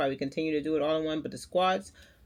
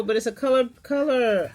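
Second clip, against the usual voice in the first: excited; 5 semitones higher, 5 dB louder than usual. Real, not acted. Excited about a colored pencil.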